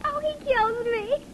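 ordinary talk

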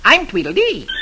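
high, sing-song little man; perky twin character voice; Tweedledee voice. A narrator's reading. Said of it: "the other says".

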